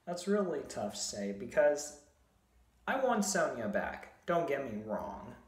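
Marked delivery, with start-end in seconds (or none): none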